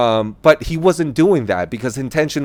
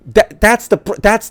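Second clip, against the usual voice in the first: outraged friend; 8 semitones higher than usual. Retold or imitated. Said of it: "And my friend's like".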